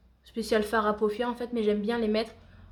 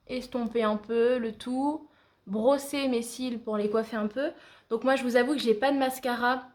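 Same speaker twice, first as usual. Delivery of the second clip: normal talking voice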